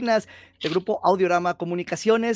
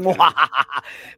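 burla con risa; 4 semitones lower, 5 dB louder than usual. Real, not acted. Laughs after teasing.

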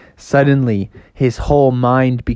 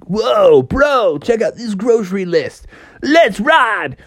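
light high surfer-dude voice; surfer dude turtle; 8 semitones higher than usual. Retold or imitated. Ninja Turtles spotting the list, hint of surfer dude. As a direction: play it like a chill skater. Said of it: "Ninja Turtles down there say".

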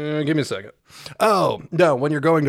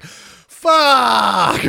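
a loud, higher mock cry of dismay; alarmed curse; 7 semitones higher, 6 dB louder than usual. [name] hearing the alarms, loud mock cry. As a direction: blurt it as the alarms blare.

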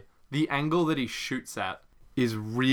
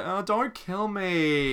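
whiny begging; 7 semitones higher, much slower than usual. Retold or imitated. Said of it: "He's like".

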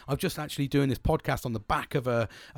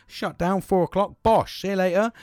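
high, loud, punchy goodbye; triumphant knocking-off call; breezy sign-off; 7 semitones higher, 6 dB louder than usual. Acted. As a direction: punch it out like clocking off on the dot. Acts out clocking off at four.

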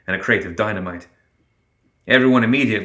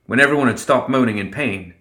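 ordinary delivery, nothing marked

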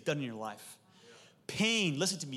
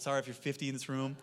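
normal talking voice

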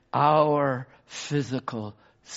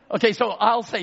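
usual speaking voice

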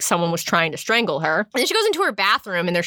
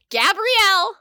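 dramatic reveal; 11 semitones higher, slower than usual. Retold or imitated.

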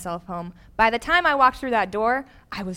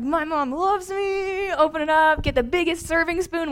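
blissful; 8 semitones higher than usual. Retold or imitated. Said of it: "and I'm like".

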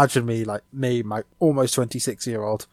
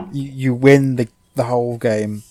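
normal talking voice

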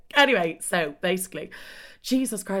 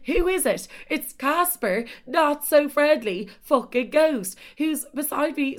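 spring the twist on the listener; dramatic reveal; 8 semitones higher, slower than usual. Real, not acted. Dramatic reveal of creepy Casper.

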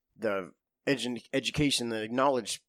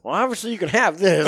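higher, louder wry give-away; dry resignation; 7 semitones higher, 9 dB louder than usual. Retold or imitated. Marine giving the kid the slobbered whistle, wry. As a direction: hand it over with mock generosity.

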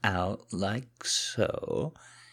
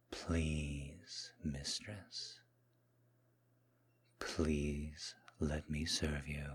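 soft, hushed, lingering murmur; submissive seduction; 6 dB quieter than usual. Acted. Kneeling man serving his Mistress, soft seductive submission.

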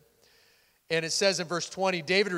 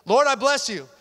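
loud, high cry of praise; stirring yourself up; 6 semitones higher, 8 dB louder than usual. Shown as a demonstration. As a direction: call out to God fervently. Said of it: "sometimes you just need to stir yourself up".

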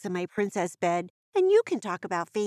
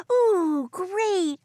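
excited gasp; 8 semitones higher than usual. Real, not acted. Gasps at mystery.

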